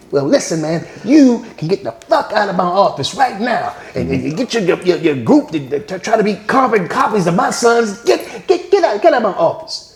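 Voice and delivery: mock-furious father telling him off; angry father rant. Father ordering Jackson out.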